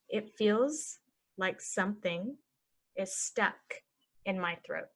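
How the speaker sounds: slow, broken into chunks; slow chunked. Shown as a demonstration. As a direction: model it slowly for learners. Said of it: "normal then slow".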